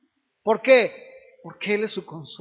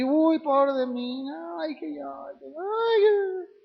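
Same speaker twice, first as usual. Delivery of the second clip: higher, slow, whimpering poor-me; hammed-up crying complaint; mock self-pitying whimper; 5 semitones higher, much slower than usual. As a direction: whine it out on the edge of fake tears.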